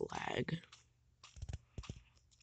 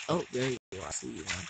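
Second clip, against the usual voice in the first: usual speaking voice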